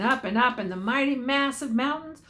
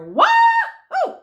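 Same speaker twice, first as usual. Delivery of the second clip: wild high whoop; yell; 10 dB louder than usual. A narrator's reading. Cowhand alone in the winter night, whooping loud. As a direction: let the whoop fly out over the snow. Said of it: "he yells".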